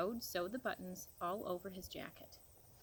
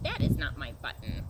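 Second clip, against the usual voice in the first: louder, lower outcry; crying out; 7 semitones lower, 9 dB louder than usual. A narrator's reading. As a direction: cry it out in protest. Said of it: "cried Toad".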